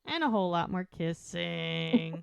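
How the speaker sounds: long, held final word, building on the joke. Real, not acted. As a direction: draw out KISSING to a comic peak. Climax of teasing kissing build-up.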